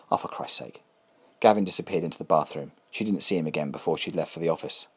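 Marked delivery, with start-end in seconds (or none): 0.1-0.8 exasperated whisper. Acted. Exasperated wife mutters at husband.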